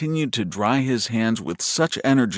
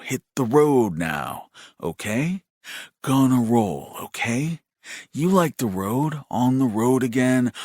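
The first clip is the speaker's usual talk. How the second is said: slow, lilting cadences with stretched words; sing-song soothing. A narrator's reading. Brother calming his shaking brother, soothing sing-song. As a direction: lull him with a steady, rhythmic chant. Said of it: "Resorting to the sing-song cadences".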